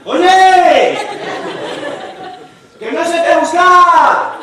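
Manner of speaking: high, loud shouted reply with a laugh; mock shout. At a disco, shouting to a friend over the music, high and loud. Comedian voices himself shouting.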